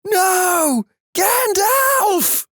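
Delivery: very high, anguished acted cry. A narrator's reading. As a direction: cry out in high, drawn-out despair. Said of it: "Once he cried out".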